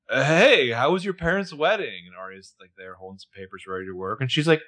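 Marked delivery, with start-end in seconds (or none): 0.1-2.0 high, raised casual call over the shoulder; acted-out friendly holler to someone behind; calling back. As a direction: call it back brightly from the fridge. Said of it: "calling back behind himself".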